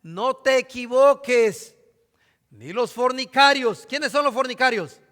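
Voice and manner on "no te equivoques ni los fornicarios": "No te equivoques" slow and louder, weighty pulpit reading; solemn scripture reading. Acted. Preacher warning his congregation, slow louder scripture reading. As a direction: declaim "No te equivoques" slowly, every word heavy.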